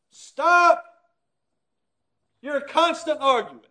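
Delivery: put-on a mother scolding two bickering kids; scolding parent. Parent tells her two children to stop arguing, sharp scold.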